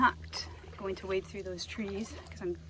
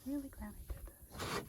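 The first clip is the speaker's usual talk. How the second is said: "Really" said much softer and higher, almost a whisper; 4 semitones higher, 7 dB quieter than usual. Real, not acted. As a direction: repeat "Really glad I did this" under the breath. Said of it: "I don't know why I'm whispering".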